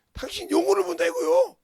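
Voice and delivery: fiery preaching shout. Real, not acted. Preacher driving point home.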